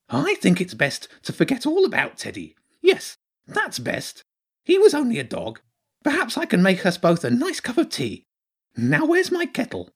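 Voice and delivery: very high, fussy little creature voice; dithering hedgehog character. Acted. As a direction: brush the matter off in a high voice.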